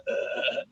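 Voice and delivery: voiceless croaked death rattle; fake dying groan. Man fakes dying in the gunfight, croaked groan. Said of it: "he fakes falling on the floor dying".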